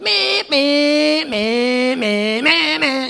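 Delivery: high, loud, choppy meh-meh engine noises; spluttering revs done with the voice; wrong engine revving. Retold or imitated. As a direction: mimic a bike being revved in stabs. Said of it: "you could hear them going".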